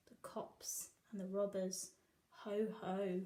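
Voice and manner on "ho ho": ordinary delivery, nothing marked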